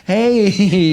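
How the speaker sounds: drawn-out mock-innocent greeting; fake casual. Walking out to friends like everything's fine, fake casual. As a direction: imitate acting like nothing happened. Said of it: "like everything's fine".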